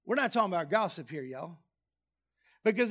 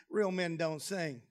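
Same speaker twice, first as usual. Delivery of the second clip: usual speaking voice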